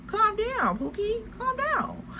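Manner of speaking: high, soft mocking "calm down"; mock soothing. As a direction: talk down to her, teasing. Mocking the jealous girl.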